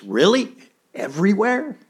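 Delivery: put-on high, wide-eyed little girl asking; a child who can't believe it; amazed little girl.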